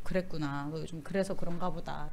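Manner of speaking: low, soft soothing tone; gentle consoling murmur. Retold or imitated. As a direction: comfort a struggling friend gently. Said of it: "달래주죠".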